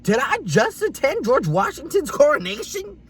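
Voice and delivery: high-pitched theatrical disbelief; hammed-up stunned question; mock incredulous. Reacting to a snooty handshake, mock incredulous. Acted outraged disbelief, much higher.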